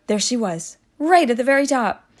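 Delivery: plain reading voice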